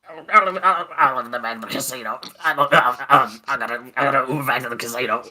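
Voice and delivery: fast, slurred-together mock rambling; hammed-up frantic babble; babbling crackhead. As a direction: stumble and restart in a put-on voice. Mimics the babbling man from story.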